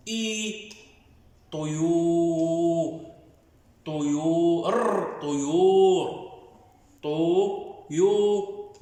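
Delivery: slow, drawn-out syllables, ط then و; spelling out syllables. Shown as a demonstration. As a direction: spell طيور slowly, syllable by syllable, then blend it. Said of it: "خلينا نتهجاها". (تهجئة بطيئة لكلمة طيور مقطعًا مقطعًا)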